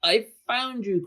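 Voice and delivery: high, delighted exclamation; happy cry. A narrator's reading. George finally finds the Yeti, happy high cry. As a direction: cry it out through a big grin. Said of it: "cried George with a big happy grin".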